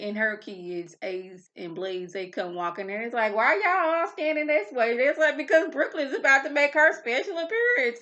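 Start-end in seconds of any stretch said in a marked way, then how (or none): 3.4-4.9 high, loud puzzled guest; curious guest. Retold or imitated. Voicing guests at party. 5.4-8.0 high, loud hyped announcement; excited announcement. Retold or imitated. Voicing hosts announcing entrance.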